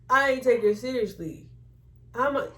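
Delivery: normal talking voice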